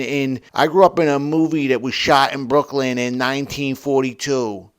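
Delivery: put-on old-movie Brooklyn accent; imitation Brooklyn gangster talk; mock Brooklyn gangster. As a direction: do a hammy forties Brooklyn wise guy. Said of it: "thirties Cagney gangster style city".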